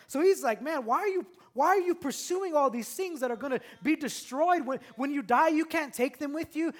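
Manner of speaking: loud, high preaching build; fervent pulpit preaching. Acted. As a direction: preach it out, rising and pushing. Preaching sermon at full force.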